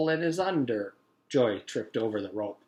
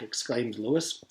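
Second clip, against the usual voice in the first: plain reading voice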